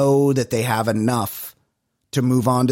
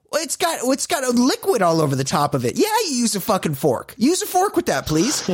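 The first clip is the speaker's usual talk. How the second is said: exasperated retort; 10 semitones higher than usual. Retold or imitated.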